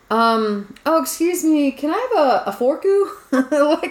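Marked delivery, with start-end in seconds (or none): 0.8-3.1 higher, Japanese-English "a forku" with ku added; Japanese-accented English. Retold or imitated. Back from Japan, ordering at a Mexican restaurant, in a Japanese accent. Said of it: "so I'm like".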